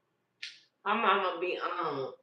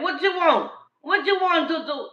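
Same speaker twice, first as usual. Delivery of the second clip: high loud eager questions; loud eager grandma; excited doting; 10 semitones higher, 11 dB louder than usual. Retold or imitated. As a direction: gush over the baby loudly.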